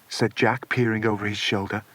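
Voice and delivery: ordinary delivery, nothing marked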